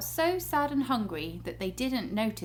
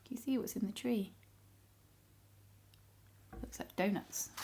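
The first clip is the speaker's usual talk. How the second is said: hushed aside; 9 dB quieter, faster than usual. Real, not acted. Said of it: "hushed aside to the child".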